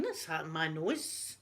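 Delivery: mock dopey patient; imitating groggy daughter. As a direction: do her woozy confused voice. Daughter groggy about nose tube.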